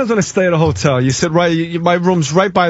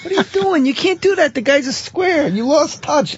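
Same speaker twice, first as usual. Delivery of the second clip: Casino character impression; 9 semitones higher than usual. Replaying a Casino scene for co-hosts, high hammed-up voice. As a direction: pitch it way up like a hammed-up film scene. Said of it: "Ace Rothstein".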